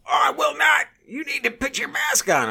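high, loud mock yelling; retold shouting. Shopper trying to get everybody's attention, mock indignant shouting. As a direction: imitate an outraged man shouting for everyone to hear. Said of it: "just shouting and shouting and shouting".

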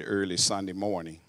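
ordinary talk

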